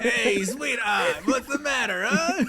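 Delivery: high, loud, drawn-out New York wiseguy; hammed-up New York tough guy; silly New York accent. Podcaster mimics the Penguin for a laugh, silly New York accent. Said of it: "he says it in a silly New York accent".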